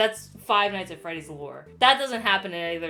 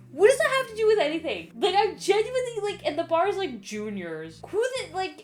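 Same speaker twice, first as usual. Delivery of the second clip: exasperated rant; 10 semitones higher, 4 dB louder than usual. Real, not acted. Ranting about the minigame.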